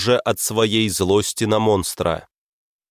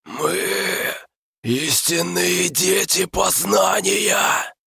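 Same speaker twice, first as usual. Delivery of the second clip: eldritch horror; 9 semitones higher, much slower than usual. Acted. Monster speaks again.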